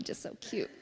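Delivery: ordinary talk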